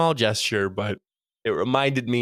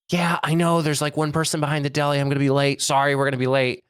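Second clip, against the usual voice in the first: hurried woman on the phone; 4 semitones higher, faster than usual. Retold or imitated. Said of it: "her husband picks up and she goes".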